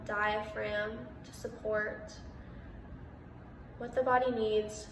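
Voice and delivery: measured meditation voice with long pauses; guided meditation. Real, not acted. Meditation host guiding listeners through breath and body awareness, calm and spacious. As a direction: guide listeners into stillness, unhurried, pausing between phrases.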